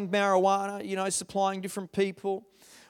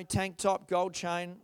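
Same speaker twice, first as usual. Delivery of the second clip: ordinary talk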